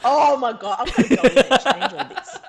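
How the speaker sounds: loud, high, laughing protest; mortified laughter. Real, not acted. Actress caught out on her CV claims, mortified and laughing. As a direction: exclaim through embarrassed laughter.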